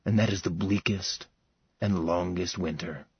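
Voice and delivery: quiet loving vow, fading away; soft, low tender vow. Acted. Max answering Sarah's love, soft and low.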